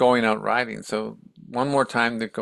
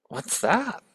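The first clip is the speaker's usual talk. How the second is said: high-pitched, startled question; puzzled question; 7 semitones higher than usual. Retold or imitated. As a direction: ask in wonder, pitch leaping up. Retold Buddha startled by dead man.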